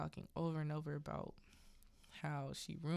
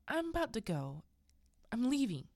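raised, louder acted resolve; firm announcement; 5 semitones higher, 5 dB louder than usual. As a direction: imitate a wife calling through the door that she's leaving. Wendy firmly announces she's leaving.

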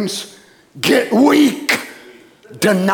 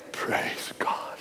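whisper; 16 dB quieter than usual. Real, not acted. Preacher drops to a whisper.

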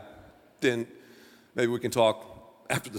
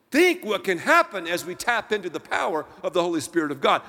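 ordinary delivery

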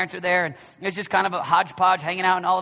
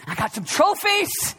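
higher, slow and proud; boasting self-satisfied man; 9 semitones higher, slower than usual. Pretend. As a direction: savour the brag. Imagined boaster at judgment.

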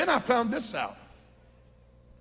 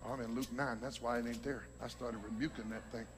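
much lower, softer aside to self; quiet, low self-correction; sheepish aside; 10 semitones lower, 11 dB quieter, faster than usual. Real, not acted. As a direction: drop right down for an offhand admission. Catches own wrong chapter mid-sermon.

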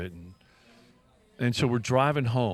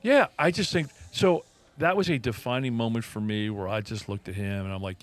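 normal talking voice

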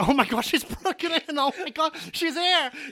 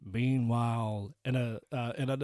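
low, slow, soft movie-trailer voice; trailer narrator; 8 semitones lower, 8 dB quieter, slower than usual. Acted. Narrator cutting to the bunker, low trailer drawl. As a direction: drag out the meanwhile like a film trailer. Dramatic scene change narration.

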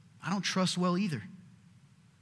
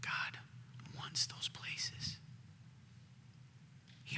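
whisper; 16 dB quieter, much slower than usual. Real, not acted. Preacher drops to whisper.

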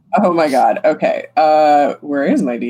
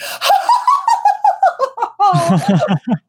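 high maniacal cackle; evil anime villain laugh. Acted. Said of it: "evil anime villain laughing".

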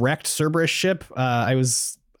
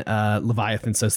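ordinary delivery

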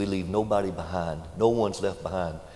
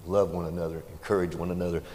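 ordinary talk

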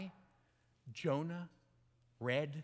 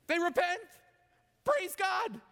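high, loud burst of praise; triumphant exclamation; 11 semitones higher, 5 dB louder than usual. Real, not acted. Preacher celebrating the Ninevites' repentance, exultant. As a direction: shout it out in triumph.

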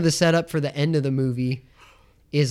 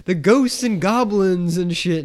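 slow, drawn-out taunt, slightly raised; obnoxious mocking; 4 semitones higher, 3 dB louder, slower than usual. Guy making fun of Friday the thirteenth, obnoxious mocking. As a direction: imitate a jerk teasing about spooky stuff. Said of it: "just being a total asshole".

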